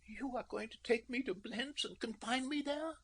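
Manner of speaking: high, hushed, frightened whisper; a small whispered question from the princess. A narrator's reading. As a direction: barely voice it, stunned. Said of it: "in a very small voice".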